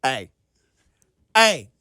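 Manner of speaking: escalating yells at the driver; building shout. Retold or imitated. Yelling at distracted driver.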